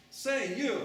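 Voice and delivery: loud, high call to a stranger. Acted. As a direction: hail him across the garden. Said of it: "imagine her saying this".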